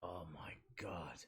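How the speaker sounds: slow stunned whisper. Real, not acted. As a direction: breathe it out in a whisper. Reacting to spicy heat.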